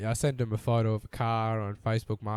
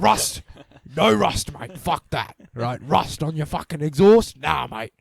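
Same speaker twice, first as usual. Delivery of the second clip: mock ranting mechanic; 10 dB louder, slower than usual. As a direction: bellow it like a fed-up tradie. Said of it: "He's like".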